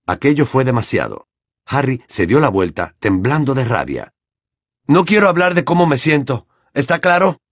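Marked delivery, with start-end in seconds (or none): 4.9-7.4 angry. A narrator's reading. Harry voiced furious.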